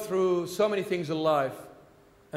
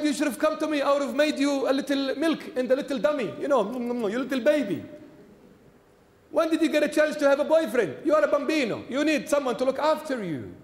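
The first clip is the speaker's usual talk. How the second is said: high, playful mock scolding of a child; teasing baby talk; 6 semitones higher, faster than usual. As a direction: coo at her like a little baby, half joking. Priest teasing heartbroken sixteen-year-old girl.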